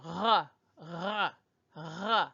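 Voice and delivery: throaty French ruh at the back of the mouth; lightly breathy back-of-mouth ruh; French R sound. Shown as a demonstration. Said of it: "I'm gonna make the R sound".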